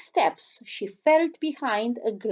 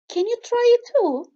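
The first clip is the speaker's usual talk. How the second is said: eager prince; 12 semitones higher, 5 dB louder than usual. A narrator's reading. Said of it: "asked the prince".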